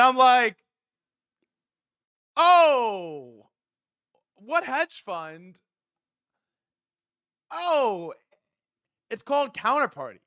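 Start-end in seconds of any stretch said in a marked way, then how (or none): 2.4-10.2 mock smug voice. Mocking own fake confident answer.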